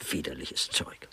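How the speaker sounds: low, soft whispered disgust; whispered contempt for the sweets. Acted. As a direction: breathe it out in distaste. Disgusted aside about marshmallows.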